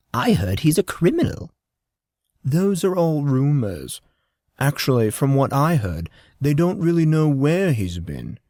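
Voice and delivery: a hint of a hushed gossiping woman; lightly voiced worried mother passing rumors. A narrator's reading. Tall blonde woman gossiping.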